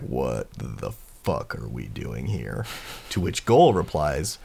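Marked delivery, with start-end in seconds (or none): 0.0-2.6 low, slow, quieter character voice; baffled Kai. Acted. Kai to companions amid chaos, low baffled grumble. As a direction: drop deep and ask it slowly, under the breath.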